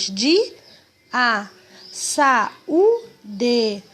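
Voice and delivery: "di, a, sa, u, de" each syllable said alone with pauses; syllables spelled out. Shown as a demonstration. Teacher sounding out hiatus syllables for the children. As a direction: tap out every syllable separately. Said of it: "Você ouve o som das duas vogais".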